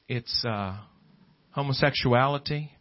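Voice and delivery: ordinary delivery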